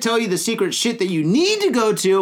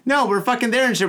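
ordinary talk, nothing marked

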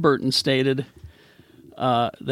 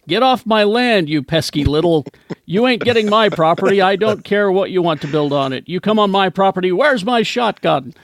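put-on grumpy old landowner; 5 semitones higher, 6 dB louder than usual. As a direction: bark like a cranky old man on his porch. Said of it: "like the old guy who owns".

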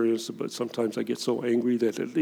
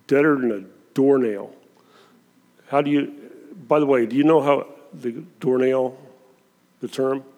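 ordinary talk, nothing marked

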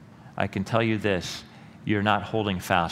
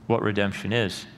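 ordinary talk

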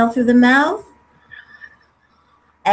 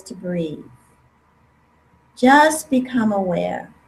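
guided meditation; 3 semitones lower than usual. Acted. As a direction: calmly guide them, unhurried. Leads a guided breathing meditation.

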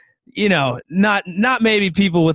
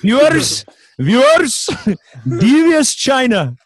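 high, loud, slow Indian news anchor; Indian accent; 7 semitones higher, 4 dB louder, slower than usual. Retold or imitated. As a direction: announce it like an Indian newsreader. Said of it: "In an Indian accent".

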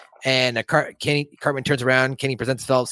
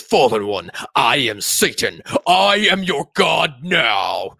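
slow, grand demon proclamation, building louder; booming Satan; 4 dB louder, slower than usual. Acted. Satan announcing himself to Kenny, slow mock-grand proclamation. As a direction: stretch it out like a cartoon devil.

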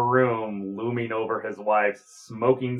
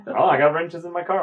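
higher, faster, mock-defensive insistence; mock insistent; 6 semitones higher, faster than usual. Shown as a demonstration. Joking to cohosts that wrenches in cars are normal, mock insistent. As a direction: protest a bit too much, tongue in cheek. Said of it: "I'm telling you".

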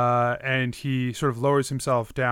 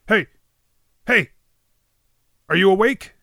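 loud, raised acted call; calling to wake someone; 6 semitones higher, 7 dB louder than usual. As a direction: rouse a sleeper with sharp calls. Voice rousing the unconscious heroine.

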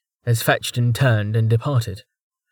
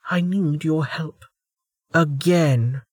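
higher, grudging admission; reluctant; 5 semitones higher, slower than usual. Acted. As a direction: force the words out unwillingly. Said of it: "as if dragged out of her".

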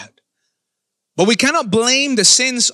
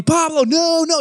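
higher-pitched imitation of an exasperated coach; mock-scold like a fed-up coach, pitched up; comic mock anger; 8 semitones higher than usual. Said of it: "he would get mad, and it was even kind of funny".

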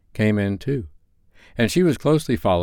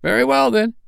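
high, loud burst; the charwoman crying out in triumph; 4 dB louder than usual. A narrator's reading. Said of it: "cried the woman".

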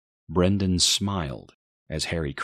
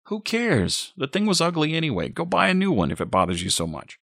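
scornful dismissal; 11 semitones higher, 3 dB louder than usual. A narrator's reading. Boyfriend shrugs off broken pot, irritated dismissal. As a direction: toss it off with irritation.

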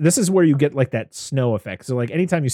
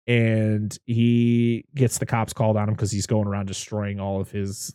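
ordinary talk

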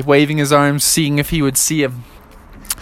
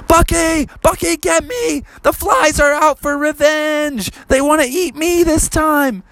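higher squeaky cry for help; tiny frog panic; 11 semitones higher than usual. Acted. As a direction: squeak it out while fleeing. Frog chased by flies.

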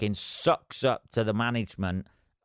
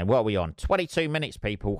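ordinary talk, nothing marked

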